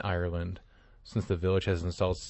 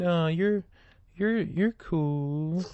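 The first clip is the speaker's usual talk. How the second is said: mock reassuring; 9 semitones higher, much slower than usual. Teasing reassurance to co-host.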